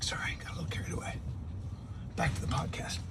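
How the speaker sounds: hushed, sheepish whisper; whispered aside. Pretend. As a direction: whisper it like an embarrassed apology.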